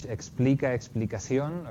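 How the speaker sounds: "explica" then "explicación", each vowel clear and full; Spanish pronunciation. Shown as a demonstration. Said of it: "This is what you get in Spanish".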